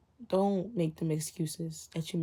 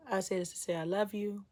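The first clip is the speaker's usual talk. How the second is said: usual speaking voice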